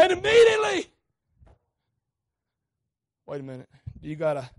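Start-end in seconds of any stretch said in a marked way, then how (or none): 0.0-0.9 immediately bursts out much higher and louder; sudden shout. Acted. Preacher shouting the miracle to the church. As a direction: explode on the word immediately.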